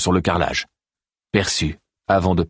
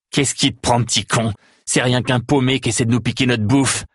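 sneering contempt; 6 semitones higher than usual. A narrator's reading. Grax sneering at Théo.

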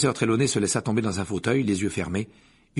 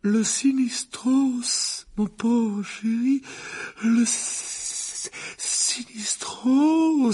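high, slow, stammering dramatic cry; exclaiming seer; 13 semitones higher, much slower than usual. Acted. The divination teacher cries out the name of the Grim. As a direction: cry out the omen in horror. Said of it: "s'écria le professeur Trelawney".

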